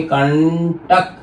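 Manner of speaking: slow "कंटक" with a long ण before टक; nasal ण demonstration. Shown as a demonstration. Teacher showing how to say it, "कंटक" drawn out. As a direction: stretch कण in "कंटक", then add टक. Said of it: "बोलेंगे".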